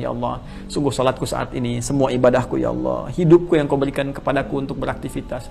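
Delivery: soft, hushed recitation and surrender; whisper-close, devoted prayer; devout prayer. Acted. Preacher reciting the opening prayer in hushed devotion.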